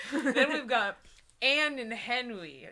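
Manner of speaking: ordinary talk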